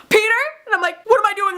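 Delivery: high, loud, nervous blurt; retold flustered panic; panicking. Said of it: "like, panicking".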